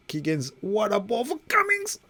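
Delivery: high, loud excited commentary shout; excited call. Real, not acted. Player cheering his winger's perfect pass, excited.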